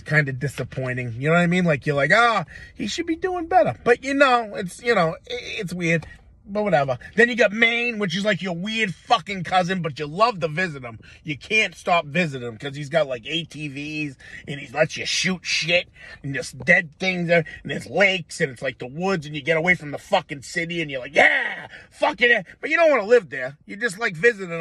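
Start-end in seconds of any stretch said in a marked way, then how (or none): 2.1-3.8 disappointed sigh. Retold or imitated. Fans sighing about older brother. 21.1-22.5 excited whoop. Retold or imitated. Getting away to Maine woods.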